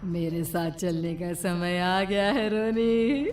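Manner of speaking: slow, soft menacing witch. Acted. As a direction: creep up on the boy. Witch comes for the boy. (धीमी, दबी चुड़ैल की आवाज़)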